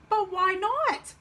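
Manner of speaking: high, drawn-out little frog whine; protesting frog. Acted. Frog protests to Dad, plaintive. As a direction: protest like a let-down child.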